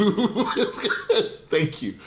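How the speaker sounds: breaks into laughter, then thanks; laughing. Real, not acted. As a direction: laugh through thank you. Laughs mid-sentence at praise.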